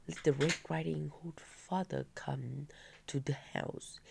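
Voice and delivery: ordinary delivery, nothing marked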